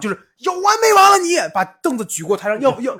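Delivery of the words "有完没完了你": higher, louder furious yell at the teacher; retold furious yell. Student lifting a stool, yelling at the teacher in fury. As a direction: bellow it like the enraged student.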